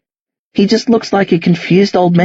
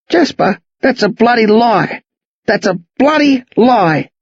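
put-on higher-pitched, indignant old man; with feeling; 6 semitones higher than usual. Old man denying he killed the girl, indignant feeling. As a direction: protest the lie with rising, heartfelt urgency. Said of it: "Lionel says with feeling".

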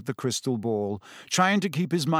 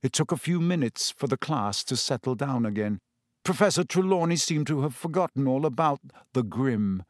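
ordinary delivery

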